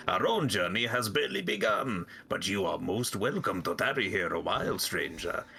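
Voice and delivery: put-on storybook flea leader with muddled French-Russian accent. Flea master invites stranger to tarry, French-Russian accent. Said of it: "Like, French Russian, both at once".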